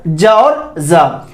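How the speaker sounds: first "जा" jaa, second zaa; jaa, then low zaa, drawn out; J versus Z contrast. Shown as a demonstration. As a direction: slowly contrast "जा" with j and "जा" with z. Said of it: "सुन पा रहे हैं अंतर".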